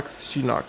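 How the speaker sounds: "Sheenock" said softly with an opening sh; sh pronunciation. Shown as a demonstration. Card reviewer unsure which name is right, soft sh pronunciation. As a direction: try "Sheenock" as SHE-nock, under your breath. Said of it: "it could be Sheenock or Seanock".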